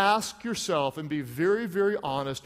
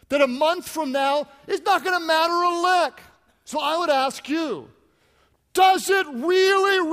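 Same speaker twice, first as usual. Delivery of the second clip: higher and louder, slowing on really; full-force preaching shout; 9 semitones higher, 6 dB louder than usual. Real, not acted. Pastor shouts at the congregation over folded towels. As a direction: thunder the question at the crowd. Preaching climax about trivial worries.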